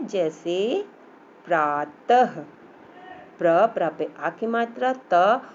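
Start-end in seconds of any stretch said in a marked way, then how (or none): 1.5-2.4 प्रातः low, ending in an audible h; visarg as h. Shown as a demonstration. Teacher gives an example of visarg, final h sounded out. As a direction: end प्रातः on a clear "tah" with breath. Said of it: "इसका उच्चारण ह की तरह होता है".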